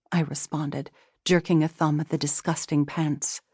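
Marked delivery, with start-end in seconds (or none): none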